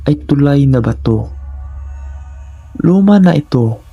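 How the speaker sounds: ordinary delivery, nothing marked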